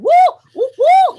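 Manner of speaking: high, loud mock woohoo; imitating teens whooping. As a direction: whoop like rowdy teens doing donuts.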